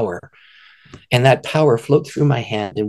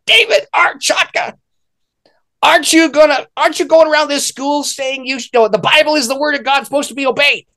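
put-on scolding girl. Imitating the girl's scolding, higher mock reproach. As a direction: imitate her telling you off, pitched up. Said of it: "She stomped her foot, her eyes lit up with fire".

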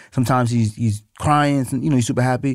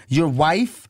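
normal talking voice

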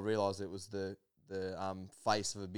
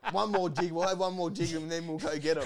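higher, louder carefree quote; imitating eager mates; 6 semitones higher, 4 dB louder than usual. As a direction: voice the boys keen for another go. Voicing the boys on the boat.

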